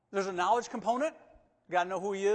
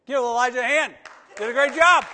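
loud, higher call for applause; rally the room to cheer; rousing shout; 6 semitones higher, 16 dB louder than usual. Real, not acted. Calling crowd to applaud.

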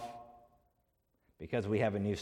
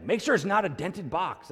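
higher-pitched fussy demand; mock commanding, in a raised pitch; imitated bossy order; 6 semitones higher than usual. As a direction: imitate an elderly lady giving instructions to her helper. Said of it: "barking orders at me like she's the general".

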